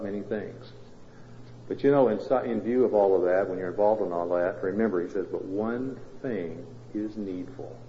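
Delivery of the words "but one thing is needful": much softer, very slow "But one thing is needful."; hushed scripture quote. Acted. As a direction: let the words of Jesus sink in, barely above a hush. Jesus' answer quoted softly.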